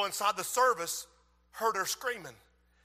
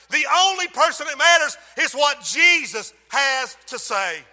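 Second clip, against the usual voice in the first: full-force preaching; 5 semitones higher, 11 dB louder than usual. Real, not acted. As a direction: drive the warning home.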